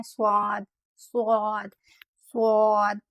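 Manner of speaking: स्वाद with a heavy whistling s; whistling swad. Shown as a demonstration. Teacher demonstrates the heavy whistling letter to students. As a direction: hiss स्वाद heavily. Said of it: "स्वाद में भी एक सीटी जैसी ये आवाज़".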